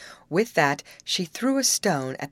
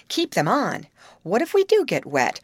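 higher, insistent urging; urgent cry; 6 semitones higher, 5 dB louder than usual. A narrator's reading. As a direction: press the point urgently. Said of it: "cried Molly".

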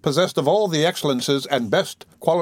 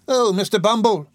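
high-pitched dismayed exclamation; distressed cry; 8 semitones higher than usual. A narrator's reading. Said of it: "cried the lady".

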